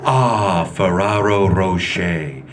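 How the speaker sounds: low, slow luxury ad voice on "Ferraro Rocher"; lightly voiced chocolate commercial; commercial voiceout parody. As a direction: purr it like a fancy TV ad. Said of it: "That's what the commercial says".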